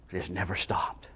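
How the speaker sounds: near-whisper, very low and soft; barely voiced, solemn repeat; whispered echo. Real, not acted. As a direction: let it sink to a whisper. Preacher repeats it as hushed echo.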